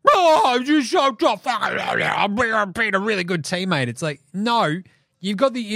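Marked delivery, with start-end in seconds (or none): 0.1-3.9 hammed-up jeering tough guy; mocking Draymond. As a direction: sneer it like a smug bully. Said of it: "Draymond's like".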